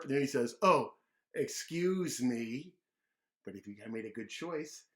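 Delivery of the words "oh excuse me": loud, slow cheeky excuse; show-off mock politeness; loud mocking apology; loud drawn-out excuse me. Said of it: "a big giant burp".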